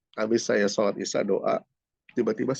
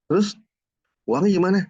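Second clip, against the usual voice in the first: retold wife's query, high and louder; wife's questioning; 10 semitones higher, 7 dB louder than usual. Wife asks husband where the money is, questioning. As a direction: imitate a wife pressing for an answer.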